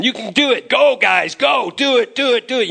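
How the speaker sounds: high, rousing rally cries; cheering them on. Acted. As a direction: cheer like a leader sending off his troops. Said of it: "David is cheering them on".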